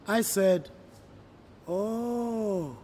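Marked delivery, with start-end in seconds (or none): 1.7-2.8 a higher, doubtful "oh", kept polite; a hint of disbelief in a child's "oh"; skeptical "oh". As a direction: let the doubt slip out without disagreeing.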